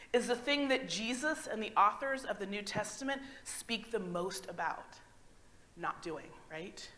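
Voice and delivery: ordinary talk, nothing marked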